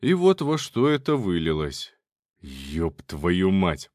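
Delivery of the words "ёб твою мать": low, slow curse through gritted teeth; seething through teeth. A narrator's reading. Uncle curses at his nephew, seething through teeth. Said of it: "процедил тайник сквозь зубы".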